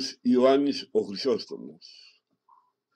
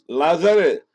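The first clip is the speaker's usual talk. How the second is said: high, loud cry of the name; loud call; 5 semitones higher, 7 dB louder, slower than usual. Acted. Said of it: "φώναξε με δυνατή φωνή".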